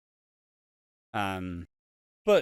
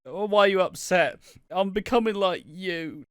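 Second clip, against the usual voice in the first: mock upset daughter; 8 semitones higher, 3 dB louder than usual. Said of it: "to be like".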